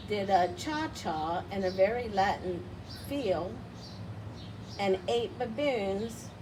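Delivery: plain reading voice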